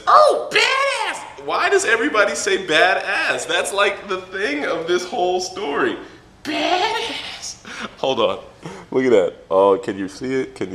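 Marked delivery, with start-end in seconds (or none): 0.1-1.4 huge high drawn-out mock shout; Naruto. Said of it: "Naruto says". 1.4-6.0 high, loud mock complaint; exasperated rant. Real, not acted. Ranting about the catchphrase. 6.4-7.7 high, squealed mock exclamation; catchphrase mimicry.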